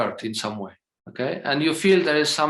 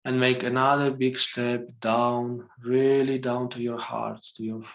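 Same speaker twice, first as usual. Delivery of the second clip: guided meditation; 3 dB quieter than usual. Acted. Teacher guiding the group into their hearts, soft meditation voice. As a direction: lead them gently inward, line by line.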